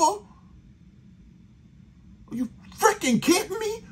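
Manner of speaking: exasperated outburst. Real, not acted. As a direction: voice jumps up in outraged disbelief. Furious rant at team's play calls.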